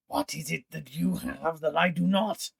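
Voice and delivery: jealous villain. Acted. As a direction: spit out the envy.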